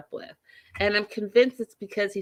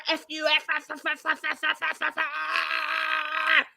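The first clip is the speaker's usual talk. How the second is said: aggressive bear; 8 semitones higher, 5 dB louder than usual. Retold or imitated. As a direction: roar and stutter curses like a raging bear. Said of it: "like, an aggressive bear".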